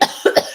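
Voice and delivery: quick cough. Real, not acted. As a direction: cough once. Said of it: "excuse me".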